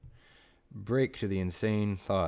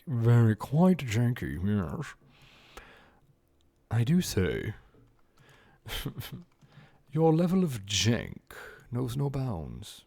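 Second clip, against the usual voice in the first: posh mock British accent, slow; 3 semitones higher, 3 dB louder, slower than usual. Teasing chat in a mock British accent. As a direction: play a stuffy British gentleman. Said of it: "How's my British accent?".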